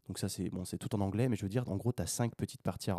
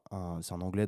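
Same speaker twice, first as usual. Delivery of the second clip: usual speaking voice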